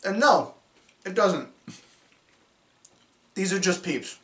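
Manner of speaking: muffled speech around food; talking while chewing. Real, not acted. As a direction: mouth partly full of candy. Seen 1: Put peeps in mouth at -5; closed-mouth chewing look throughout.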